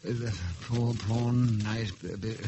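low, halting mumble, losing track; soft, hesitant, trailing into confusion; faltering. Acted. Loses track of imagined game.

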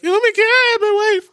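shrieky high, loud mock outburst; mock whining. Podcaster mocking the slapper's reaction, high mock wail. Mocks a slap-and-whine reaction.

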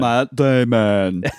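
slow mock-dramatic Matt Damon; drawn-out Matt Damon. Real, not acted. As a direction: savor "Matt Damon" slowly like a punchline. Echoing for comic effect.

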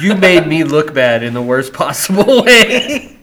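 slow, loud telling-off breaking into laughter; hammed-up disappointed dad; mock scolding. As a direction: scold the kid but crack up. Plays father scolding daughter.

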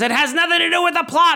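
high, loud exasperated shout; mock scream. Susan's dad yelling at Frank, high shout. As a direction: shout it at a trivial complaint. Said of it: "Susan's dad starts screaming".

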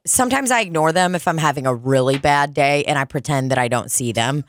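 ordinary talk, nothing marked

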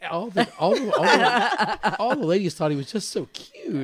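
laughing through the words; giggling while speaking. Real, not acted. Laughing while telling story.

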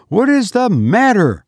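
high, urgent demand; insistent repeated question. A narrator's reading. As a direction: press for an answer, pitched high. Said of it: "repeated Missus Townsend".

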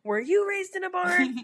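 high, mocking scolding voice; angry man voice. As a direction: mimic a snippy man scolding. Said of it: "points at me and says".

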